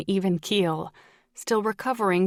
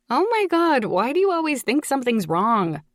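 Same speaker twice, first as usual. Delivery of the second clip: higher, louder whine of a dramatic daughter; whining; 6 semitones higher, 4 dB louder than usual. A narrator's reading. Daughter on the phone to her worried mother, whining. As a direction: whine it in exasperation, starting high. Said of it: "Violet whined".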